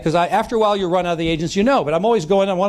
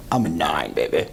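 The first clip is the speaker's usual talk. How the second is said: much lower, swaggering mock boast; cocky bragging realtor; 13 semitones lower, 3 dB quieter than usual. Rare confident agent boasting to him, in a deep mock swagger. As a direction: drop way down and brag like a big shot.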